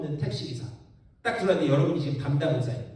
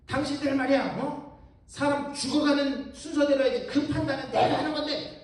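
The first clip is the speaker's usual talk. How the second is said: a raised, higher mock doctor's rebuke; retold yell at patients; 7 semitones higher than usual. Young doctor snapping at the angry patients, mild mock yell. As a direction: scold them like the young doctor shouting. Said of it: "버럭 소리를 질러요".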